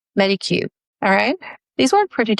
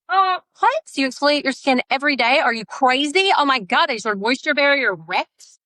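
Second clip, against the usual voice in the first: higher, incredulous imagined critic; mock shocked skeptic; 6 semitones higher than usual. A skeptic viewer scolds her exfoliating habit, higher and incredulous. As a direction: play a viewer aghast at daily exfoliating.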